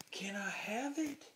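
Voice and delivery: high, drawn-out mock-innocent question; retold cheeky request, stretched out; cheeky plea. Thief cheekily asks bouncer for table.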